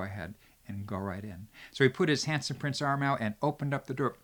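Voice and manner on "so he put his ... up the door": ordinary delivery, nothing marked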